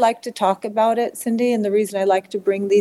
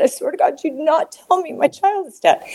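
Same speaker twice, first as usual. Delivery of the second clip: high, insistent desperate plea; desperate warning; 9 semitones higher than usual. Retold or imitated. Mother begs not to hear her child is dead, desperate warning. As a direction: swear it in high-pitched refusal. Mother refusing news of death, retold.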